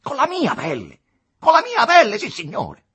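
loud, high furious insistence; inflamed with anger. A narrator's reading. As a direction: hammer it home in a blazing temper. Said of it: "infiammato d'ira". (infiammato d'ira, a voce alta)

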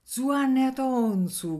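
high, slow call stretching the name; glad call. A narrator's reading. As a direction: hail him across the yard. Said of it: "gridò lo studente".

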